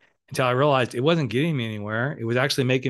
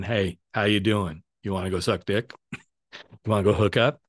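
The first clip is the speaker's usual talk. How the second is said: low, lightly voiced pick-up line; mock a casual come-on, pitched lower; 4 semitones lower than usual. Guy hitting on someone on New Year's Eve, mock come-on.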